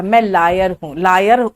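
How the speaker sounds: लायर as "laayer", sounding like "liar"; wrong regional pronunciation. Retold or imitated. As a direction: mimic लायर with open aa for lawyer. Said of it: "लॉयर बोलो".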